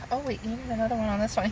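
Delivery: ordinary talk, nothing marked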